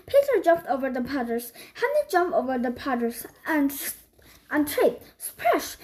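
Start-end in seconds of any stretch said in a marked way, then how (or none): 5.2-5.8 squeaky high splash. A narrator's reading. Splash sound effect.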